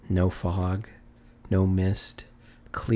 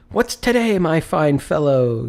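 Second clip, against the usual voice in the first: high, loud friendly hail; calling out cheerily; 6 semitones higher, 5 dB louder than usual. A narrator's reading. Scrooge calling down to the boy, loud and cheery.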